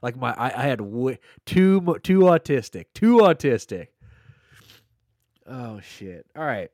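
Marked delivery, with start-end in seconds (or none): 1.4-3.9 loud. Real, not acted.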